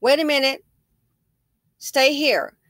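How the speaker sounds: commanding the stranger to stay put; firm command. Retold or imitated. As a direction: stop her at the porch firmly. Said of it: "So I said".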